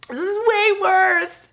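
high, loud mock despair; drama queen. Insisting to the chiropractor, hammed-up high despair. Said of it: "drama queen".

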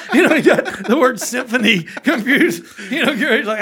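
high, loud talk through laughter; laughing while talking. Real, not acted. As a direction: explain the joke still laughing. Explaining joke while laughing.

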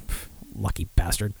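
normal talking voice

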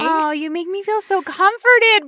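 high, drawn-out sarcastic thanks; mock gratitude. Teasing Brittany for her support, sarcastic thanks. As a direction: ham up being comforted. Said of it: "sarcastic reply to friend".

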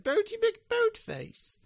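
high, slow, posh snooty voice; snooty posh accent. Retold or imitated. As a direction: draw it out like a snooty aristocrat. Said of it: "like a snooty accent".

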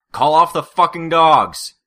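huge, slow, furious bellow. Acted. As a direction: roar it with everything. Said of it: "I heard Brian bellow".

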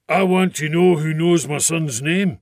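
loud, demanding; a red-faced man storming in; angry father. A narrator's reading.

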